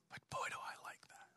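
hushed, voiceless confiding; awed whisper. Retold or imitated. Newcomer walks into church and feels something new, awed whisper. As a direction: whisper it in quiet wonder.

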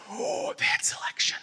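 breathless, hushed awe; awed whisper. Retold or imitated. Shopper telling listeners about the shirt selection, awed whisper. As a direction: whisper it like a discovery.

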